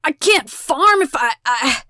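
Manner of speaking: high, loud stammered protest; panicked stammer. A narrator's reading. Rodney pleads for his fingers, stammering. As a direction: falter on the last words in alarm. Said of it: "Rodney stammers".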